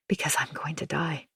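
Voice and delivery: soft despairing whisper. A narrator's reading. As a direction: whisper it, hopeless. Despairing inner thought.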